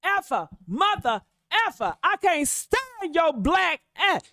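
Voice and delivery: mock angry father cussing; imitating cussing father. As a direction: spit the insults like an angry father. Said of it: "He probably cuss him".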